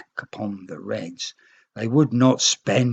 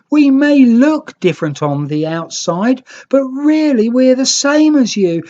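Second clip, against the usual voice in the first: high, loud earnest plea; pleading green nicks; 13 semitones higher, 8 dB louder than usual. Acted. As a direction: ask the neighbours to make peace.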